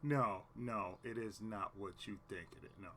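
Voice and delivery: ordinary talk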